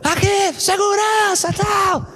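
high shouted call for the guard, in a retold scene; yelling for security. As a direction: holler across the mall for security.

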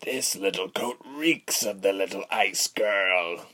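slow, gloating villain voice; rough, drawn-out menace; coarse villain. A narrator's reading. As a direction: relish the scent without hurry. Villain gloats over the cloak.

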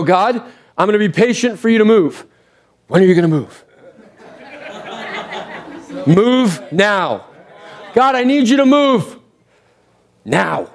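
half-joking impatient plea; impatient prayer. Retold or imitated. Believer praying to God, mock impatient. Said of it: "We go".

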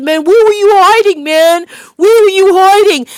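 high, loud amazed playground shout; excited kids' cries. Retold or imitated. Kids praising the last one caught, excited shouts. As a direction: shout it like an impressed kid.

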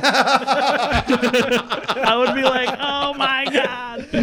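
high, drawn-out cracking-up exclamation; loud helpless laughter through the words; laughing. Real, not acted. Parent imagining possessed child, laughing.

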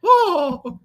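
very high demented cartoon mouse; Mickey Mouse. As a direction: keep up a mouse falsetto, ending on a loud exclamation. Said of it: "really good at Mickey Mouse voice".

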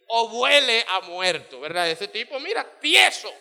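loud preaching climax. Real, not acted. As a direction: preach it full force to the crowd.

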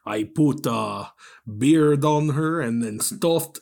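slow, loud mock Russian accent. Joking as the bear-making villain, slow Russian accent. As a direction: boast slowly in a thick Russian accent. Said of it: "when you do a Russian accent".

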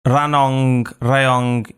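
Ranong, Rayong spaced word by word; slow careful repeat. Shown as a demonstration. Learner copying the guest's tongue-twister, slow and separated. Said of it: "you say more faster".

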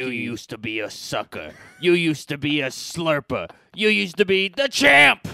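trainer pep talk. Pretend. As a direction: fire them up like a boxing coach. Rocky-style trainer pep talk.

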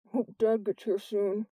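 slow, thick mumble; mumbled. A narrator's reading. Jordan examining his hurt lip, slow mumble. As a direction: mumble slowly through an injured lip. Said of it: "Jordan mumbled".

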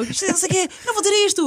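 high mock protest, mildly outraged; indignant refusal. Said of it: "E então".

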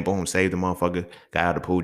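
ordinary talk

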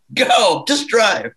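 imitating a panicked dad yelling at the driver; panicked order. Imitating dad urging the driver to go, panicked. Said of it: "starts freaking out".

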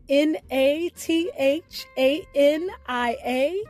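ordinary delivery

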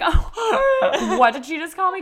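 high, loud outraged surprise with a laugh; mock-shocked grandfather. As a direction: play the scandalized grandpa, laughing. Grandfather's shocked reaction retold.

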